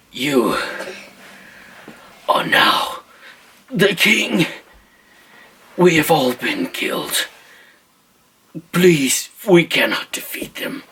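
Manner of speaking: lightly voiced wounded messenger; halting words with long pauses; a hint of a dying man's last plea; dying squire. Dying squire addresses the new king.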